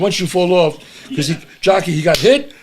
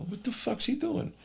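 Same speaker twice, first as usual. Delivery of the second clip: very soft, higher-pitched wondering; puzzled muttering; 6 semitones higher, 16 dB quieter than usual. Retold or imitated. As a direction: mutter it to yourself, baffled. Puzzled thought while waiting in car.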